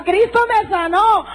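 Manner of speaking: high, slow ecstatic cry; retold cry. Healed woman crying out in church, high and slow. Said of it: "Otra gritaba".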